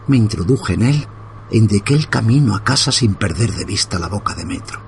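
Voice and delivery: plain reading voice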